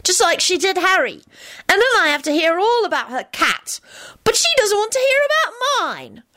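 put-on very high, whiny child voice; disgusted Teddy. A narrator's reading. Teddy griping to Elizabeth about his babysitter, disgusted. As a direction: pitch it way up as a put-out little kid. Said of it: "Teddy told Elizabeth disgustedly".

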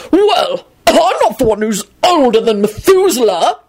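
high, blustering retort; affronted old-gentleman bluster; shouted. A narrator's reading. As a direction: shout it back indignantly, slightly reined in. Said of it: "Richard shouted".

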